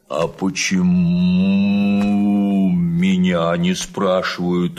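cow voice. Acted. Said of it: "вмешалась она".

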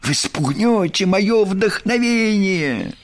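high, stretched-out poet's wail; theatrical fretting over a disturbance; grumbling complaint. A narrator's reading. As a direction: protest slowly and plaintively, pitched well up. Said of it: "проворчал Бараш".

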